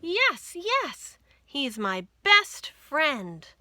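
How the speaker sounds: high, loud yes yes, then slow and loud; joyful shout. Acted. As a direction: cry out with joy at finding him. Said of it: "shouted Lisa".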